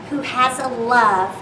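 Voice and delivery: plain reading voice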